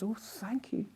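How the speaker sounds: breathy, very soft whispered thank-you; hushed, whispered gratitude. Retold or imitated. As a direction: whisper your thanks, touched. Her own retold reply.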